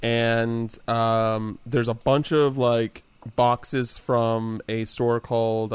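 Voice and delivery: ordinary delivery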